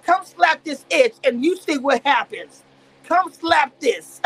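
loud, slow, drawn-out challenge; hammed-up slow yelled taunt; mock shouted dare. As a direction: bark the dare slowly like picking a fight. Retold dare to fight.

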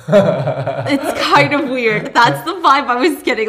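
real laughter while talking; laughing. Real, not acted. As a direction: giggle it out.

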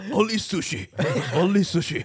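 laughing mock Japanese accent; lightly put-on Japanese accent, giggling. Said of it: "why the guy has a Japanese accent".

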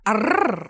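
loud growling pirate arrr. Pretend.

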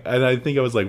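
usual speaking voice

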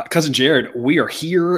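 ordinary delivery

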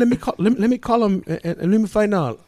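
high mock woman's voice; high-pitched, stumbling female imitation; flustered wife impression. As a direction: stammer it as the rattled wife. Flustered wife ends the call.

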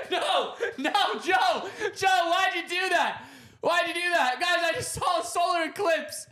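high-pitched, loud mock shriek; hammed-up panic. Telling everyone about seeing a solar eclipse, hammed-up panic. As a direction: wail in over-the-top horror.

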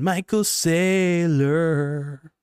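hammed-up slow high name call; drawn-out name. Shown as a demonstration. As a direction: croon "Michael Saylor" in that same odd stretched voice. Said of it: "I don't know why I say that like that".

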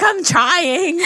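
high, mock-desperate reply; exaggerated strained whine; straining to speak. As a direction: push it up high, imitating a struggling voice. Retold struggle to talk while hoarse.

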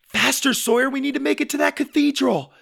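high, loud, pressing call; frantic girl calling out; urgent urging. A narrator's reading. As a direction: drive a brother onward. Urging brother while fleeing bulls.

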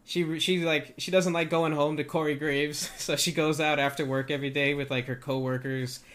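ordinary talk, nothing marked

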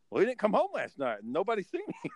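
high, worried retold line; lightly voiced worried friend. Quoting John, higher voice.

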